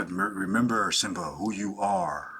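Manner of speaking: low, soft, slow Lion King father; Simba's dad. Retold or imitated. Friend quoting Simba's father to Quinn, low slow solemn. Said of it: "in Lion King, Simba, Dad".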